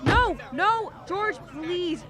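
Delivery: desperate pleading. Acted. Mary pleading with Ernie to stop George, loud and desperate. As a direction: cry out to stop him leaving.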